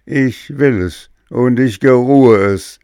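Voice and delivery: loud, high, imperious greedy demand; greedy ogress tone. A narrator's reading. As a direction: bark it like a glutton queen. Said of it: "rief die Königin in einem Entenfresserton".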